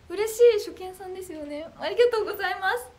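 squealing high and loud with delight; thrilled thanks. Real, not acted. Idol thanking a new fan, squealing high and loud. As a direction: burst out with delight.

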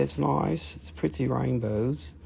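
usual speaking voice

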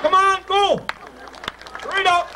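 high, hurried call to get moving; urging shout. Real, not acted. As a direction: chase him along with a yell. Hurrying the guest out.

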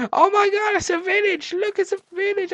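high-pitched, thrilled squeal; thrilled discovery. Real, not acted. Player spotting a village in the game, high and thrilled. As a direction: gasp out the find, voice way up.

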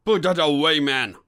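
urgent order, easing at the end; warning. A narrator's reading. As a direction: warn a partner to stand down. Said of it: "warned Aldborough".

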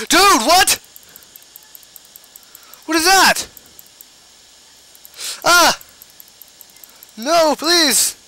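very high frantic no's; high panicked pleading. Real, not acted. Frantic fight with slimes.

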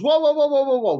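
rapid high, loud cries to halt; mock alarm. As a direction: everyone panicking, hammed up. Everybody's alarm acted out.

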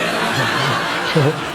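low chuckling laughter; laughing. Real, not acted. Comedian laughing after the grandpa joke, low chuckle. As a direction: crack up at the punchline.

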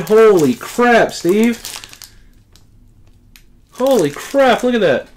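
high, thrilled exclamations of disbelief; real high-pitched amazement; excited awe. Real, not acted. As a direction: blurt it out, genuinely stunned by the gift. Opening a prized graded comic.

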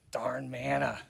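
whispered, muttering complaint; whispered grumble. Acted. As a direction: grumble it under your breath. Grumbling aside about the manna.

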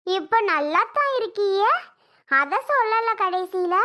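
ordinary talk

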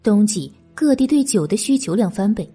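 plain reading voice

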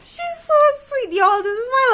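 high, loud, cooing praise; gushing. Real, not acted. Praising her little sister to viewers, cooing. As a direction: coo over her like a proud sibling.

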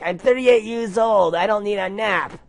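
high, loud protest; shouting back. Acted. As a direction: yell it back annoyed. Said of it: "I shouted back".

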